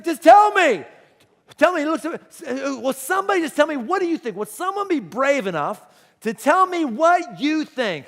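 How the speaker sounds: loud, high, exasperated demand; acted-out exasperated shout; frustrated outburst. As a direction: plead in loud, mounting frustration. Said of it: "Jesus gets frustrated".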